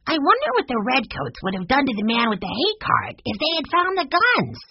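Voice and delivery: put-on high-pitched little-boy voice, climbing at the end; curious young Benny. A narrator's reading. Benny wondering about the redcoats and hay cart, eager. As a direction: wonder aloud in a high child's voice.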